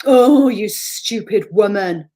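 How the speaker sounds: slow, loud, sneering inner voice; nasty inner critic. Retold or imitated. The inner critic sneering at herself, slow and loud. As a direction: drag it out with contempt. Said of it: "It probably says".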